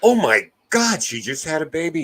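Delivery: high-pitched imitation of an amazed wife; exclaiming wife. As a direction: mimic a wife's envious disbelief, starting high. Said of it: "my wife hates her because she was like".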